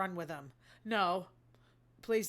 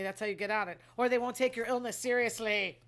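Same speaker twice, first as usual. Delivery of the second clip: ordinary talk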